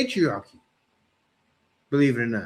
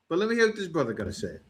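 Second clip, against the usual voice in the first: ordinary talk, nothing marked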